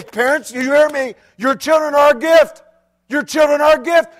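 loud, high preaching call; preaching to parents. Retold or imitated. Preacher retelling how he exhorts parents, loud. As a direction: drive it home to the parents. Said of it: "I'll say".

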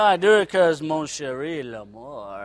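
hammed-up French romancer, dropping deep at the end; mock French suitor. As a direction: croon low in pretend French. Acting his French love line.

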